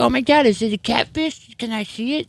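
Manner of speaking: put-on high, excited girly voice; imitating the girls. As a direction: mimic the giddy girls asking to see. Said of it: "They're like".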